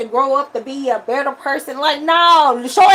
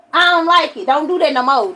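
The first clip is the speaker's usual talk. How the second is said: ordinary delivery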